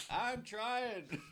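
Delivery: dad's voice. Retold or imitated. Dad voiced, pathetic.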